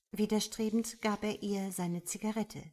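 ordinary delivery

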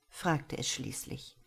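plain reading voice

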